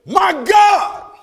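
high, loud cry of "My God"; loud preacher's cry; anguished cry. Retold or imitated. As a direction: cry out to heaven. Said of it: "on Calvary he said".

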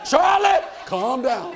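a firm call of his own name, then laughing; father calling out. Retold or imitated. Father admitting he talks to himself, firm then amused. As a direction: tell yourself to calm down, half laughing. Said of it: "I'm talking to myself".

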